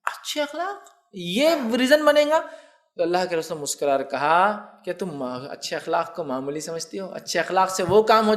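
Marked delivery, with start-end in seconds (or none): 0.0-2.4 high, slow, doubting wonder; incredulous question. Retold or imitated. Wife asking the Prophet, high and slow in disbelief. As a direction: ask it as if it can't be enough. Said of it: "انہوں نے کہا".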